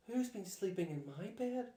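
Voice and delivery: a mild squeak like a startled mother bear; squeaking Mummy Bear. A narrator's reading. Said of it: "squeaked Mummy Bear".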